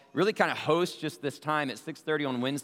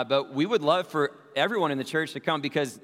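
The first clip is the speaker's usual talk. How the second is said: ordinary talk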